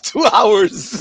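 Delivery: laughing out two hours, high-pitched; a laugh breaks into the words. Real, not acted. Mocking the long pre-show to friends, laughing high.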